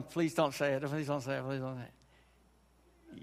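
mock pleading that trails off into silence; pleading inner voice. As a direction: beg silently, dying out to almost nothing. Listener's dreading inner voice.

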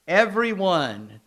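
drawn out, slow and loud; slow emphasis. Real, not acted. Preacher calling congregation to attention, slow and emphatic. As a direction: lean hard on Everyone to grab the room. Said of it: "listen to what I'm saying here".

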